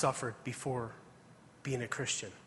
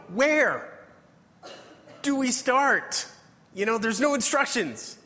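high, loud, whiny mock question; clueless typical guy; 8 semitones higher, 4 dB louder than usual. Boy asks his uncle where to start reading, mock whine. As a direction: play the lost kid who has no instructions. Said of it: "As a typical guy, we go".

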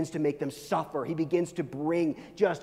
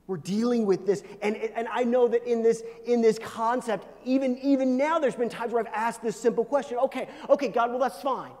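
high, loud, urgent sermon voice; impassioned preaching; 8 semitones higher, 9 dB louder than usual. Real, not acted. Preacher wrestles with God over our fault, impassioned preaching. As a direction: argue it out at full force.

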